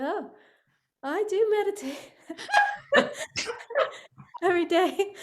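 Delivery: high, loud laughing through the words; laughing reply. Retold or imitated. Telling a colleague she meditates daily, laughing loudly. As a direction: burst out laughing as you protest.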